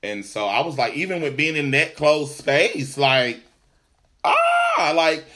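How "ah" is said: very high, loud squeal of dread; mock scream. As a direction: let out a piercing comic shriek. Dread of riding in close space.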